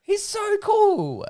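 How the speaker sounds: high slow adoring squeal. Real, not acted. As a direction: gush in a squeaky voice. Arguing about Pegasus.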